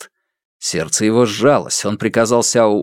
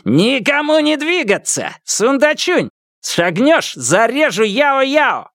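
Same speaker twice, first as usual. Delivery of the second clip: screaming threat; slower than usual. Acted. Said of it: "Лу Цзиньтан завопил".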